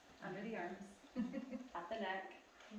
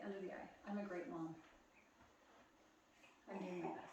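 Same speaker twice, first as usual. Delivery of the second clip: soft affirmations; 3 semitones higher, 7 dB quieter than usual. Shown as a demonstration. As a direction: murmur each affirmation gently to yourself. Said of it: "whisper this to yourself".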